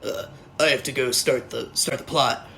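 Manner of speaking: a hint of a flat gruff Batman; lightly voiced Batman. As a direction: mumble it like Batman on a chore. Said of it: "Batman decides".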